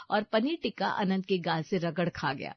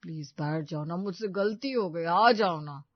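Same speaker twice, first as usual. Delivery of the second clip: put-on low, gentle boyfriend pleading; apologetic coaxing; 3 semitones lower than usual. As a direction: beg her to get in. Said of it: "विनती शुरू की".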